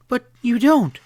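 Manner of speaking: Kitty pleads with Joe, panicking, voice climbing; rising hysterical. A narrator's reading. Kitty begs Joe in a high hysterical cry. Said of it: "Her voice rose hysterically".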